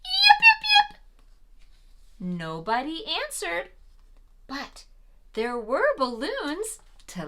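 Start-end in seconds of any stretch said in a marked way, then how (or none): 0.0-1.0 very high, loud little yips; a little fox calling out across the hill toward the music; fox yipping call. Acted. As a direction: call out like a small fox into the night. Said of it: "and she called".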